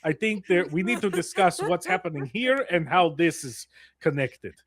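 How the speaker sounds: in-character loud reprimand; loud stern lecture. Acted. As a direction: call out a friend's behavior. In-character rebuke to Zake.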